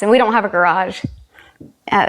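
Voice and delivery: ordinary delivery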